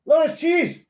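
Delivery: loud high call across the house; calling the dog. Real, not acted. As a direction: shout the dog's name to fetch her.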